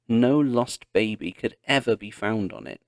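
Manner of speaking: ordinary delivery, nothing marked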